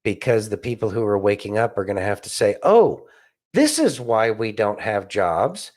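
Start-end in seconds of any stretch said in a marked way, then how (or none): none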